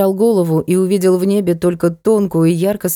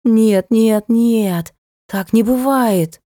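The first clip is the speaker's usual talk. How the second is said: thin mewing wail; 3 semitones higher, much slower than usual. A narrator's reading. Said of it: "мяукающе тоненько".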